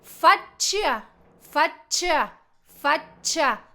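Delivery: "Faccia" as FAT-cha, silent i; Italian pronunciation. Shown as a demonstration. Teacher drilling the word for face, "faccia" as FAT-cha. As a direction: hold the double c in "faccia" before cha. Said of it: "No pronuncio la I".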